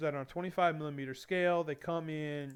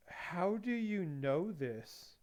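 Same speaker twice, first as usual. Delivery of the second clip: drawn-out, slow mock amazement, a bit softer; slow amazed question; 3 semitones higher, 5 dB quieter, much slower than usual. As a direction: stretch each word like a stunned coworker. Coworkers amazed at hobby.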